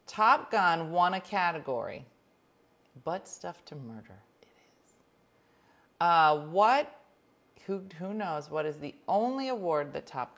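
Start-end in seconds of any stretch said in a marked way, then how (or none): 3.0-4.9 breathy whispered joke under the breath; whispered aside. Real, not acted. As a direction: toss off the misread title in a whisper. Mumbled aside over a title.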